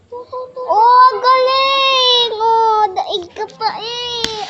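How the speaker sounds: slow, high, loud delighted cry; excited gushing. Real, not acted. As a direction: gush over the pet's trick. Gushing over the pet's trick.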